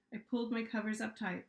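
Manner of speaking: plain reading voice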